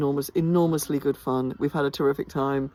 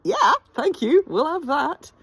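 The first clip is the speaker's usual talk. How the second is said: much higher, slow sarcastic thank-you; mock thanks; 12 semitones higher, slower than usual. Thanking the weather for rain between shows, mock grateful and much higher. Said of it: "I'm like".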